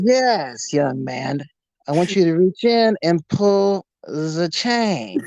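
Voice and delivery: put-on slow, measured German accent. German stranger coaxing a young man to reach in, German accent. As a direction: coax as a creepy German stranger. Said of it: "German accent".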